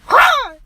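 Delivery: loud, high mock "rah"; playful pounce noise. Boy pounces with a twist, playful loud rah. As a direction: burst out with a sudden rah.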